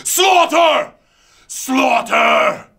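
high bellowed name; villain yell. Acted. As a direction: roar the name like a war cry. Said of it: "a couple more slaughters".

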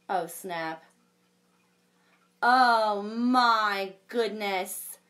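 lively storybook read-aloud with big reactions; reading to children. A narrator's reading. Librarian reading a unicorn story to children, animated. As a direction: read it like a picture book for kids.

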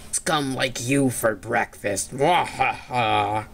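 low slow monster threat with evil laugh; slow theatrical villain menace. Acted. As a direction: growl it out like a cartoon villain.

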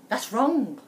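loud protesting wolf; indignant cry. A narrator's reading. Wolf protests that Red skipped his teeth line, loudly. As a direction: bark the correction. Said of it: "cried Wolf".

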